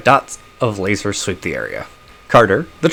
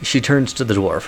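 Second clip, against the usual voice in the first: ordinary talk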